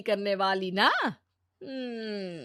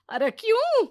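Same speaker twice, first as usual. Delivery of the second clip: much higher, louder shocked outburst; 11 semitones higher, 6 dB louder than usual. Acted. Mother-in-law hearing she must give up her power, shocked cry. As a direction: jolt up in disbelief.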